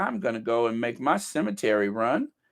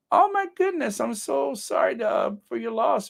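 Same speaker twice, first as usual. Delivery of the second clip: shocked sympathy; 10 semitones higher than usual. Real, not acted. Reacting to a widow's message.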